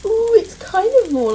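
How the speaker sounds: high loud pleased ooh; surprised. Real, not acted. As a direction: sound genuinely impressed. Surprised at flipped bread.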